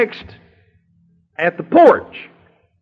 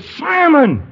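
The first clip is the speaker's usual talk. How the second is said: Jesus rebuking Simon; 7 semitones higher than usual. Acted. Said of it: "And our Lord grabs him by the wrist and said".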